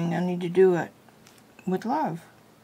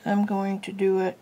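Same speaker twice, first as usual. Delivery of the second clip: usual speaking voice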